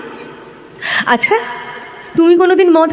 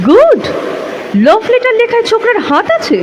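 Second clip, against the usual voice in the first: mocking the husband; 6 semitones higher than usual. Telling her lover how her husband read the letter, mock sneer. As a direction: put on his smug tone as you quote him. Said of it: "পড়ে বললে".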